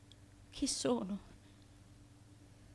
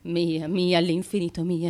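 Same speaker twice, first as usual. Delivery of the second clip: louder, possessive desperate cry; 9 dB louder than usual. Acted. Periandro crying out to Melissa, possessive and desperate. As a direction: repeat "mia" like a lover who cannot let go. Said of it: "me lo dice disperatamente".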